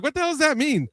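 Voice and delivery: incredulous. Retold or imitated.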